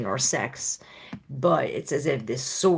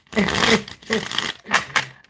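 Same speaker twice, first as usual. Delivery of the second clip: breathy giggle; 9 semitones higher, 4 dB louder than usual. Real, not acted. Laughing at someone unseen.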